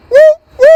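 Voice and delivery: short high siren whoops; high "woo, woo" like a police car; police siren imitation. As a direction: mimic a siren with two quick woos.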